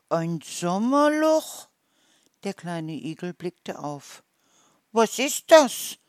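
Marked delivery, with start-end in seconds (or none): 0.1-1.7 higher, louder, drawn-out question; puzzled surprise. A narrator's reading. Little hedgehog puzzles over an unknown word, surprised. 4.9-6.0 high, loud puzzled question; baffled question. A narrator's reading. Hedgehog has never heard of this, loudly baffled. Hedgehog asks about unknown thing.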